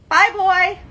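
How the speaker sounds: a high, loud sassy send-off; dismissive. Retold or imitated. Leaving the ex who wanted her business gone, sassy and loud. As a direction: toss him off with attitude. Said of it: "And I'm like".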